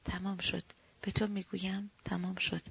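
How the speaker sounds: soft, gentle reassurance; calm, as to a child. A narrator's reading. Shonenbaum tells frightened Glukman it is over, calm and soft. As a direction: speak quietly, as if soothing a child.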